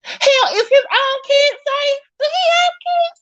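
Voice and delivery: real upset, voice shooting way up; squeaky-high alarmed questioning; voice going high. Real, not acted. Alarmed whether Josh's own kids are safe, voice going very high. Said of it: "My voice is going high".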